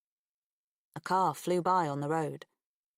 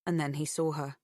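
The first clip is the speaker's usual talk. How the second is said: usual speaking voice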